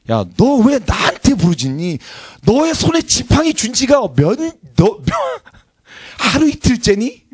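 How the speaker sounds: high, slow rebuking voice of God; scolding God. Acted. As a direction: scold Moses like an impatient father. (꾸짖는 하나님 목소리로 천천히 높게)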